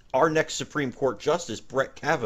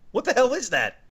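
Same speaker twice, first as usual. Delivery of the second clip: shocked; 11 semitones higher than usual. Acted. Host shocked by costume.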